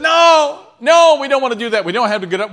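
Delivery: high, loud cry of refusal; loud protest. Acted. Frightened people refusing God on the mountain, loud protest. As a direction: start on a high drawn cry, easing as you plead.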